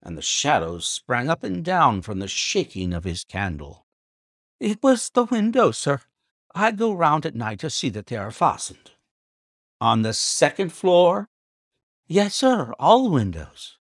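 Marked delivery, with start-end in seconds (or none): 4.6-13.8 high, strained, anxious servant; high trembling butler voice; agitated butler. A narrator's reading. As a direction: nervously excuse yourself. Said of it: "His agitation was so great".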